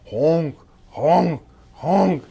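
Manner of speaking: loud goofy honk noises; silly honking. Pretend. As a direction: honk like a clown horn. Teacher imitating horn sounds.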